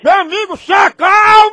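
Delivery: high, loud plea to calm down. Real, not acted. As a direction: shout over him to settle down. Prankster calms shouting victim.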